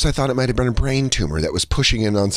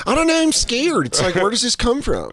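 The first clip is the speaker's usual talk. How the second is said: scared parent; 9 semitones higher than usual. Acted. Mock frightened parent.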